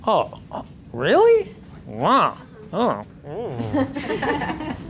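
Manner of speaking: exaggerated disapproving groans with a sharp high "really"; a client showing displeasure without words; negative reactions. Shown as a demonstration. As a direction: ham up reluctant, unimpressed noises. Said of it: "negative stuff too, like make it".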